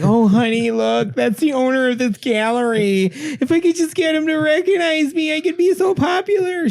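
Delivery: very high, gushing falsetto; hammed-up falsetto spouse voice; comedy-bit spouse. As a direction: pipe it up high, wistful and eager. Comedy bit voicing the painter.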